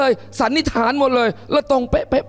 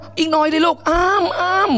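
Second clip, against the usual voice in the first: coaxing mother; 5 semitones higher than usual. Retold or imitated. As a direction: coax the child to take one more bite. Mother urging the child to eat.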